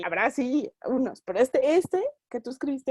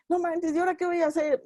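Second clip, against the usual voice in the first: fast, higher comic panic; fretful mock superhero; 6 semitones higher, faster than usual. Superhero panics about chores during a fight, fast and high. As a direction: rattle it off like a hero distracted mid-battle.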